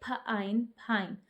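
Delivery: फ, आइन, फाइन split into sounds, then blended; teacher sounding out फाइन; sounding out with magic e. Shown as a demonstration. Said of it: "फेन को पढ़ेंगे".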